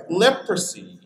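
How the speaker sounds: normal talking voice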